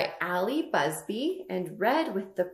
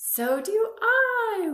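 excited; 14 semitones higher, 7 dB louder, slower than usual. Acted. Excited answer to kids.

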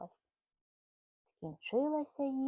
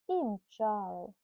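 i mchaly drawn out slower as a trailing echo; slowed echo; 3 semitones lower, slower than usual. Acted. Mother echoing the chase line to her son, slower trailing repeat. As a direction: let the repeat slow down and fade.

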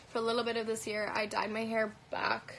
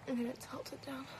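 whisper; 2 semitones higher, 8 dB quieter than usual. Real, not acted. Quiet aside while adjusting camera.

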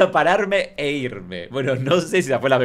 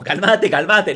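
mock frantic calming; 6 semitones higher, 7 dB louder, slower than usual. Acts someone grabbing panic victim.

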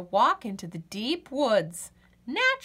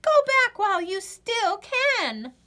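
much higher, louder urgent plea; warning rabbit; 10 semitones higher, 4 dB louder than usual. Acted. Rabbit dashing in to warn Birdie, high urgent plea. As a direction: beg her to turn back, rabbit-like. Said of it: "Rabbit dashes onto the scene".

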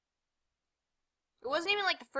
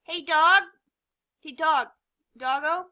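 loud, higher call to a dog; 5 semitones higher, 11 dB louder than usual. Real, not acted. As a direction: call the dog to get its attention. Calling out to the dog.

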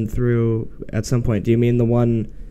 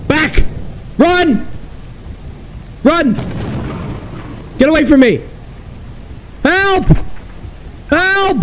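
panicked shouting. Real, not acted. Player screams voice commands at the game to escape, panicked. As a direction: yell commands in a real panic.

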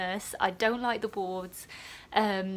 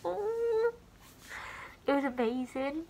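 high, tearful cry of wonder; emotional, tearful; 7 semitones higher than usual. Real, not acted. Student nurse moved at being remembered, real tearful wonder. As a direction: voice lifting high as happy tears well up. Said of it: "I'm gonna get emotional again".

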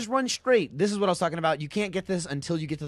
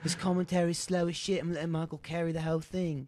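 lightly mocking co-host impression; softer, lightly put-on teasing voice; Gav; 7 dB quieter than usual. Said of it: "there's Gav when there's just like".

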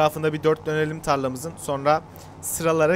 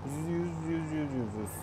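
soft, rapid repeated muttering; muttered counting; 7 dB quieter than usual. Real, not acted. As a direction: count softly to yourself. Counting aloud to himself. (kendi kendine mırıldanarak)

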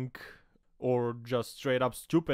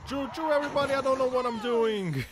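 much higher, louder silly voice; high goofy voice; 12 semitones higher, 4 dB louder than usual. Pretend. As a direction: play a clueless driver, goofy and high. Voicing the crashing driver.

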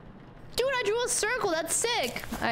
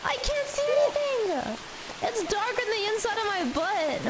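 whining, much higher pitch; whiny high voice; 7 semitones higher than usual. Real, not acted. Lost in dark game level.

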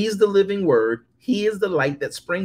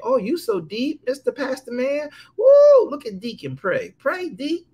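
high mock churchgoers fawning; mocking admirers; 8 semitones higher, 3 dB louder than usual. Preacher mocks fawning churchgoers, high and gushing. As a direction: gush over the pastor in mock awe. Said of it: "men will think".

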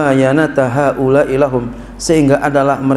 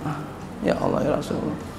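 breathy whispered plea to God, fast; exasperated whisper; 11 dB quieter, faster than usual. Real, not acted. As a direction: sigh it out in a whisper, despairing. Exasperated sigh at preachers.

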